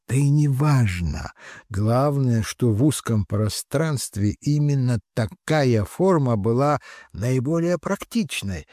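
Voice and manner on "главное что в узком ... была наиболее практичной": usual speaking voice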